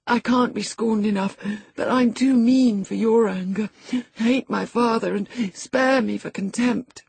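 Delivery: high-pitched, anxious self-abasement; abject pleading. A narrator's reading. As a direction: plead with her, belittling yourself. Frightened boy begs angry cousin's forgiveness.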